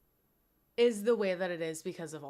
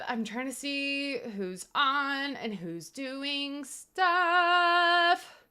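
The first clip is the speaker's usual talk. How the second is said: higher, slow goofy voice; 7 semitones higher, 5 dB louder, much slower than usual. Pretend. Streamer browsing channels in a goofy voice. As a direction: keep the silly high tone going. Silly high voice continues.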